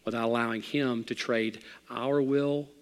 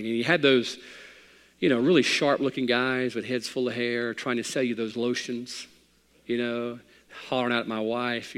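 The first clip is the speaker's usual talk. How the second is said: usual speaking voice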